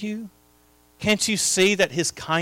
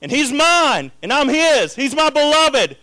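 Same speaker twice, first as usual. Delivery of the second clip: high, fervent declaration; high-pitched pulpit cry of devotion; impassioned preaching; 8 semitones higher, 4 dB louder than usual. Real, not acted. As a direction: preach it out with rising passion. Preaches at full force.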